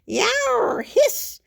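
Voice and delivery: high cat yelp and loud hiss; yowling cat. A narrator's reading. Kitty getting shampooed, angry yowl and hiss.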